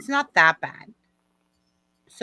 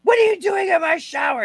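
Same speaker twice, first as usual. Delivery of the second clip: high-pitched, raised outraged shout; retold dad's yell; 10 semitones higher, 5 dB louder than usual. Daughter replaying dad's look and words, angry yelling. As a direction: shout it like a shocked, angry dad. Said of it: "he was like".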